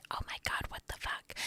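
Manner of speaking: a hushed, appalled aside; quoting a listener's whispered shock. Retold or imitated. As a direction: whisper it, stunned. Viewer's shocked reaction, quoted.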